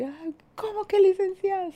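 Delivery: high, indignant offended squeal; ¡ay, cómo que licenciado! mucho más agudo; offended president. Retold or imitated. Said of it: "Así". (el expresidente ofendido, chillón e indignado)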